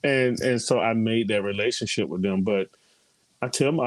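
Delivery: ordinary delivery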